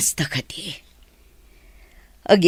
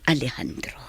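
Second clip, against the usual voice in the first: soft breathy whisper of his name; forgiving him, barely voiced; 3 semitones lower, 7 dB quieter than usual. (naalumamay nga arasaas)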